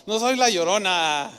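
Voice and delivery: hammed-up ghostly denial played for laughs; a light parody of a wailing phantom; joking wail. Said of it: "Yo me imagino, hasta con cierto humor".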